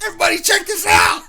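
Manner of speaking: loud high laughing shout; mock kid yelling with a laugh; taunting kid. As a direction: yell it to the whole hallway. Kids mocked at school.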